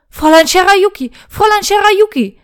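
loud, high-pitched cries of alarm; frantic knight bursting in, shouting; panicked shout. A narrator's reading. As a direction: call out her name in panic. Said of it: "rief der Ritter panisch".

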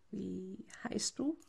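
soft, slow, hesitant question; unsure. Acted. Shy boy asks his rescuer's name, soft and hesitant. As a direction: ask it timidly and slowly. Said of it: "frage ich und sehe ihn unsicher".

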